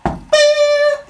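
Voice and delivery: very high, loud sustained squeal; high-pitched "beep" noise; imitated power noise. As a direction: make a piercing sound effect. Noise of the divine reveal.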